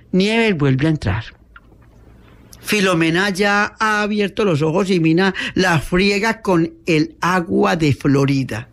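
ordinary delivery, nothing marked

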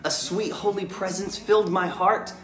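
put-on reading a rhymed story aloud to children; lilting storybook read, rising with the joy; story narration. A narrator's reading. Dad reads his Christmas book to the kids, storybook voice.

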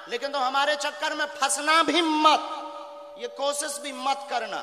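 high-pitched forceful declaration; stern public warning. Real, not acted. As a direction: warn off a challenger in front of a crowd. Warning the reporter not to test him.

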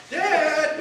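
put-on high, loud child calling out Dad; a kid yelling for Dad to tattle; child calls out to father, tattling and loud. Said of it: "It's like support in our house".

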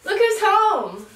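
high, slow sing-song greeting; welcoming someone home. Real, not acted. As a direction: happily greet him at the door. Greeting husband.

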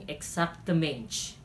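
"exactamente" ending in a soft "ch"; softened Portuguese ending. Shown as a demonstration. Teacher turning Spanish into Portuguese, "exactamente" said as exactamench. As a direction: soften the end of "exactamente" to "mench". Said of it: "pinalambot mo lamang ang pagkabigkas".

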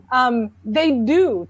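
usual speaking voice

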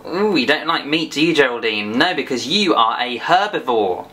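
usual speaking voice

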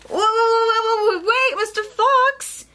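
high, urgent storybook call; shouting giraffe. Acted. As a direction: call out high to stop someone leaving. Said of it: "shouted Giraffe".

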